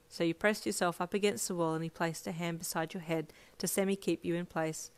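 plain reading voice

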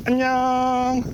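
high, sing-song bye; cute goodbye. Real, not acted. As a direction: chirp a cute farewell. Signing off vlog.

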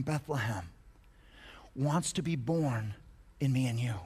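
slow, low, hushed delivery; reverent, measured sermon close; solemn preaching. Real, not acted.

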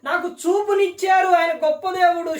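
loud, high joyful praise; glorify God with thanks; praising God. Retold or imitated. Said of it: "దేవునిని మహిమపరచుచు అంటే". (ఆనందంగా స్తుతిస్తూ)

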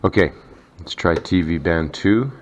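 ordinary talk, nothing marked

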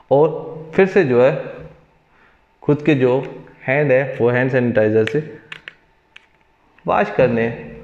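ordinary talk

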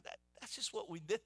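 patronizing. Retold or imitated.

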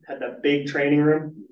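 ordinary talk

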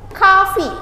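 coffee said as kafi, high and clear; model pronunciation. Shown as a demonstration. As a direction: say coffee as KAH-fee with a bright falling tune. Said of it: "Karena dalam bahasa Inggris memang seperti itu". (coffee diucapkan kafi, nada tinggi lalu turun)

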